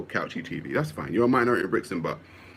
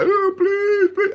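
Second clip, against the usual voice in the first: very high, louder pleading oh please; mock begging; 6 dB louder, much slower than usual. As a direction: whine it like a desperate beggar. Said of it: "I just feel like I'm begging".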